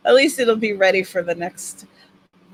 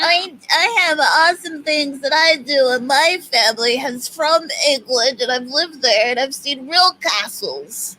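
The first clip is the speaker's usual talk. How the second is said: lightly whiny mocking imitation; teasing in a mild whiny voice; 5 semitones higher, 3 dB louder than usual. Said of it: "That's my, my whiny voice that I use for literally everybody".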